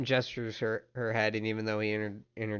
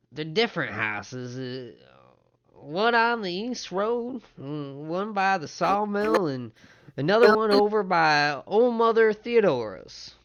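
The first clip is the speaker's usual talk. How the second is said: put-on older townsman; 8 semitones higher, 7 dB louder than usual. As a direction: swing the pitch up and take your time. Game master voices the older man.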